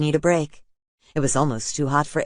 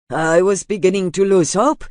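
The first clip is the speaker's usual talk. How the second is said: higher, louder, relieved outburst; relieved father; 6 semitones higher, 6 dB louder than usual. A narrator's reading. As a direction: let the relief spill out. Relieved father talks to Aiden.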